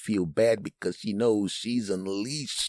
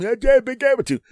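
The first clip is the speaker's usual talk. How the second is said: very high, loud blustering gibberish; hammed-up bluster, words tumbling into nonsense; mock tough guy; 7 dB louder than usual. Husband arguing with wife by phone, loud mock bluster. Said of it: "Captain Tough Guy goes from".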